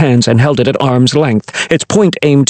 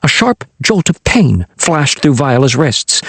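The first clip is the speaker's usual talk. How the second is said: ordinary delivery, nothing marked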